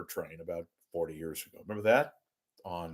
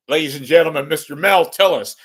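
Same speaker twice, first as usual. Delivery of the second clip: loud, raised introduction; hammed-up emcee intro; mock stage announcer; 4 semitones higher, 8 dB louder, faster than usual. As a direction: announce the act with a big voice.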